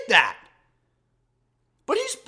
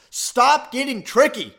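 ordinary delivery

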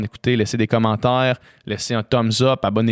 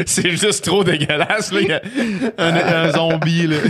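laughing; 6 semitones higher than usual. Real, not acted. Laughing at gross image.

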